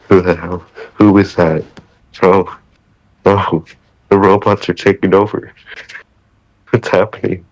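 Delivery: normal talking voice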